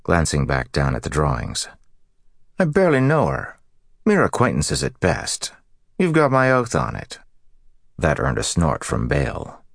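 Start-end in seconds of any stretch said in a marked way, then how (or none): 2.6-7.2 high, hammed-up protest; mock earnest. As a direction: overplay the denial, tongue in cheek. Matt's mock-earnest denial.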